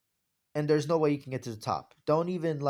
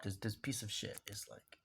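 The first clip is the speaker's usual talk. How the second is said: whisper; 3 semitones lower, 13 dB quieter than usual. Real, not acted. Frustrated with phone.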